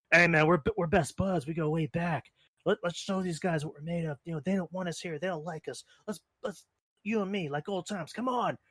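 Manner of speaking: high, pleading buddy voice; Sami Zayn impression. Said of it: "Sami Zayn goes up to Kevin Owens and says".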